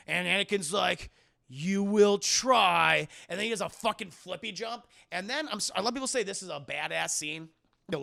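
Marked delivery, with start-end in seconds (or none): 1.5-3.1 slow ominous voice. Retold or imitated. Anakin threatening Obi-Wan, acted.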